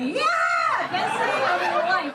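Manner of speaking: yelps way up high with joy; a loser's high-pitched whoop of pure delight; ecstatic loser guy. Retold or imitated. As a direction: whoop like the luckiest loser alive. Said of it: "He's like".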